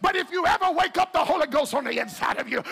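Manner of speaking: loud, high, shouted preaching over music; shouted sermon climax; full-force preaching. Acted. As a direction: preach it hard and climb.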